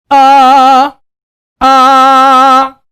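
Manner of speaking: loud, high sung ah in head voice. Shown as a demonstration. Vocal coach demonstrating the high head voice. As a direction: sing ah with a bright head resonance. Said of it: "Suena con un carácter mucho más agudo".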